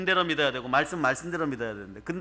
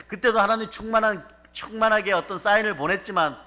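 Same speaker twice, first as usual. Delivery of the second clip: intense preaching; 5 semitones higher, 5 dB louder than usual. Real, not acted. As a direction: lean into the horror. Rising sermon about nation's ruin.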